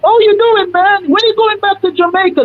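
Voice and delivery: very high, eager imitation; excited relative chatting. Girl's family greeting him on the phone, high-pitched gushing imitation. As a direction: gush in a high mock voice at the caller. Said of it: "And they're like".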